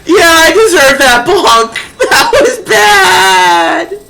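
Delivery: high, loud, wheezing with laughter; laughing hysterically. Real, not acted. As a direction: laugh through every word. Laughing at own blunder.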